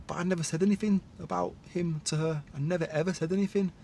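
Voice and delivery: ordinary talk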